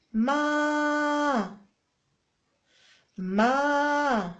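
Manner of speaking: long held ما; drawn-out syllable ma. Shown as a demonstration. Mother modelling the letter sound to her child. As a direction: stretch out ما for the child. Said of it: "يوقف ولا يخرج".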